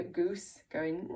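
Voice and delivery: usual speaking voice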